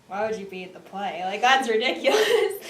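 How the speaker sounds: dismissive mock scorn, ending with a laugh; mocking scoff. Student imitating her younger self scoffing at drama kids. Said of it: "so I was like".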